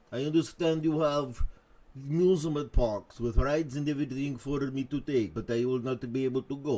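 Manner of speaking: hammed-up bad Russian accent; poor Russian accent. Host voicing Soviet leader's park complaint, poor Russian accent. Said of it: "very poor Russian accent impersonation".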